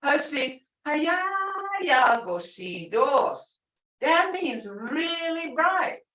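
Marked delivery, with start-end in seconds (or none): 0.0-3.6 hayayagosidos raised and drawn out very slowly; tone for emphasis. Shown as a demonstration. Teacher showing how tone adds emphasis, drawn out. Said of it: "put a tone in it and say". 4.7-6.1 "really" drawn out long and high; emphatic tone. Shown as a demonstration. Teacher showing what the tone means, stretching "really". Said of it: "See how tone did that?".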